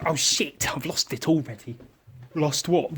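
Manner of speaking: ordinary talk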